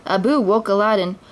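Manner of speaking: ordinary delivery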